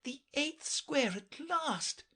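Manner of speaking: high, slow delighted cry; eager cry. A narrator's reading. Alice reaching the eighth square, crying out high and slow. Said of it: "she cried".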